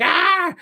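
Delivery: high, loud "ah!"; sudden playful yelp; mock scare yell. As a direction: jump out with a teasing scare shout. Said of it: "teases Laurie with the Michael Myers mask".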